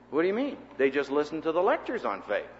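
ordinary talk, nothing marked

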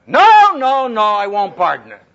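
loud sharp refusal; shouting. Retold or imitated. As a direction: cut him off loudly. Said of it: "He used to say".

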